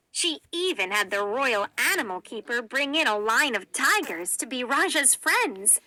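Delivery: plain reading voice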